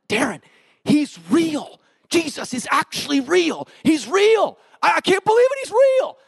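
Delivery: building to a high, loud, overjoyed cry; ecstatic disbelief. Retold or imitated. Retold ecstatic outburst to friend.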